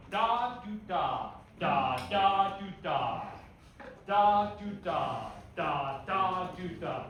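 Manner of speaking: "Da" punched loud, staccato "do, da" lighter; accent after soft note. Shown as a demonstration. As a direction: hit "Da" hard and keep "do, da" short and soft. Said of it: "make the staccato note a little bit softer".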